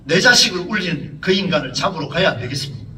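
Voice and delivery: ordinary talk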